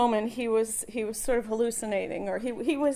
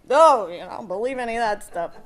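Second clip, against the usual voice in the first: ordinary talk, nothing marked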